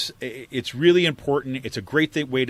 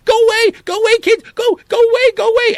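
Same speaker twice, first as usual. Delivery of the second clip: very high, loud mock shriek; Nancy Pelosi; 13 dB louder than usual. Politician shooing off activists, high mock shriek. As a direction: frantically shoo them in a squeaky yell. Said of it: "She's like".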